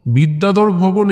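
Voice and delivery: usual speaking voice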